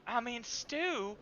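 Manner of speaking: mock Southern belle. GM plays Eliza reading menu.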